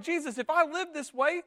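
freaking out in protest; worried pleading. Acted. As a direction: pile up the worries anxiously. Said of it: "We're worried".

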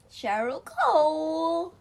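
high, slow, loud pun voice; silly sing-song voice. Pretend. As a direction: stretch out the pun playfully.

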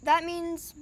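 talking with a mouth full of granola. Real, not acted. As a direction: chew and talk at once. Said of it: "sorry, I'm eating a granola bar".

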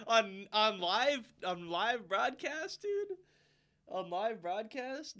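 slow, high, drawn-out disbelief; incredulous. Retold or imitated. Retelling an f-bomb on live broadcast, incredulous. Shocked at swearing on air.

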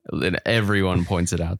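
usual speaking voice

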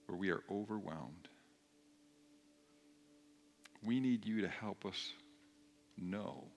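soft, low-key prayer with long pauses. Real, not acted. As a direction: lead them gently in prayer, pausing between phrases. Leads the congregation in prayer.

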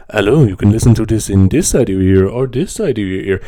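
a hint of an intimate ASMR host, close to the mic; playful parody of an ASMR greeting; mock ASMR voice. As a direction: tease the listener like an ASMR video. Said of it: "calling A S M R a smear".

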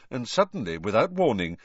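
ordinary delivery, nothing marked

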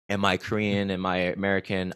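ordinary talk

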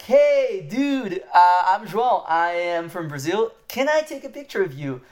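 high-pitched, eager hello to a stranger; friendly, open greeting. Retold or imitated. Tourist asking the Prince for a picture, friendly and open. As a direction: re-enact an upbeat, friendly approach. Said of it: "I was very, like, open with him, very friendly".

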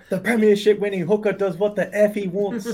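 lightly done Irish fighter boast; Conor McGregor impression. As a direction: swagger it like Conor McGregor at a press conference. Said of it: "he goes full Conor McGregor".

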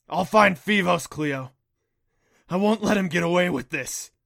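loud, determined declaration; fierce vow. Acted. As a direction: swear it with fury. Vowing revenge for dying naiad.

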